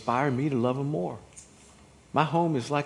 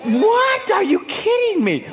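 high-pitched, disbelieving outburst; loud, high comic disbelief; mock incredulous; 8 dB louder, slower than usual. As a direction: act out utter bafflement at your spouse. Acts out spouses' baffled look.

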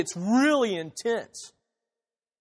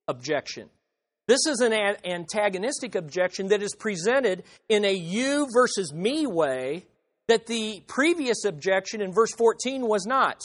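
usual speaking voice

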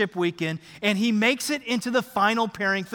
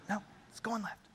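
very soft, stubborn reply; quiet greenside objection; hushed insistence; 16 dB quieter than usual. Acted. Acted son's quiet last word.

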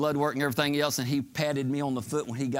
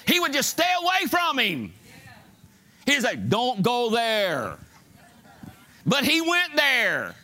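high, driving sermon delivery; full-force preaching; 9 semitones higher than usual. Real, not acted. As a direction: preach it hard, pitched up, stretching key phrases.

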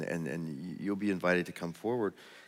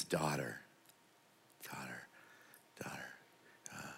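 soft and lower, each word quieter and gentler; hushed tender Jesus voice; tender, fading; 8 semitones lower, 15 dB quieter than usual. Acted. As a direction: speak to her like a loving father, letting it fade. Jesus speaks tenderly to healed woman.

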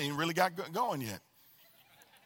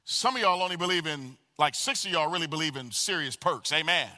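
ordinary talk

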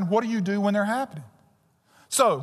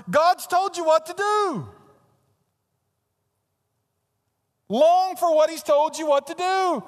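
fervent preaching; 6 semitones higher than usual. Acted. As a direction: preach it with rising fire. Pastor rises to fervent exhortation.

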